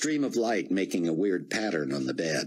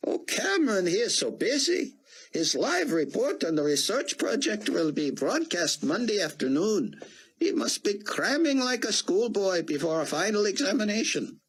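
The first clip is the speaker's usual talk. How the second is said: put-on high, foreign-sounding older gentleman; old Israeli scholar; 14 semitones higher than usual. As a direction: play the kindly old statesman, higher. Rosenzweig voiced by the narrator.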